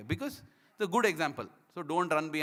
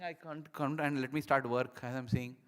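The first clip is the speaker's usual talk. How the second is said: ordinary talk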